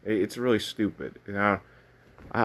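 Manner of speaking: usual speaking voice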